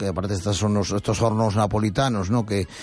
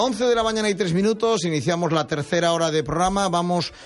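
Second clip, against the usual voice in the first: bright on-air hour announcement, pitched up; radio announcer; 4 semitones higher than usual. Acted. Host opening the third hour of the show, announcer voice. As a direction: launch the new hour like a DJ.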